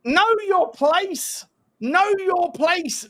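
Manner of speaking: put-on high, slow taunt at a kid; mock him slowly from up high; taunting jeer. Taunting a young opposing player.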